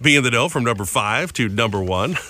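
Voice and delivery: normal talking voice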